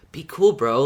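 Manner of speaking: ordinary talk, nothing marked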